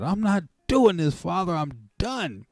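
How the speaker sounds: high, loud firm declaration; declaring to Father. Retold or imitated. Woman telling God she is done smoking, high loud resolve. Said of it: "I said".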